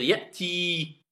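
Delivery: Ya'ti with a long held final ee; prolonged vowel. Shown as a demonstration. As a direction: draw out the end of Ya'ti. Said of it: "Normalement, je dois dire".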